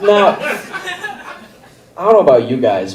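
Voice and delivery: usual speaking voice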